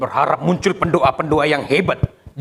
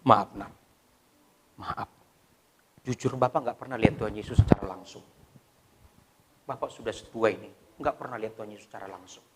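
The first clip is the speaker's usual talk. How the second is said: low, very soft fatherly tone; humbly hushed, speaking tenderly to a child; gentle apology; 7 semitones lower, 11 dB quieter, faster than usual. Retold or imitated. Father apologising to his crying child, soft low gentle voice. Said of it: "saya minta maaf".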